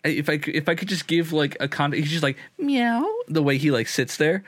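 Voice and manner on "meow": very high dainty meow; cat meow. Acted. Benny the cat sitting there luxuriously, imitated meow. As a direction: imitate a pampered little cat.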